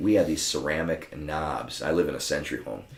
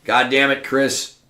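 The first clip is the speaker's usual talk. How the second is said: a louder, higher mock-angry gripe, slower; gruff complaint; 7 semitones higher, 5 dB louder, slower than usual. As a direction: grumble at the host like Cass would. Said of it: "contrast that to what Siva Cass would".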